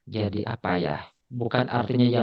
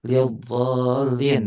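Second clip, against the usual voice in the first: lil dhalin read slowly and deliberately; slow example; much slower than usual. Shown as a demonstration. Teacher reads the Arabic example slowly to students.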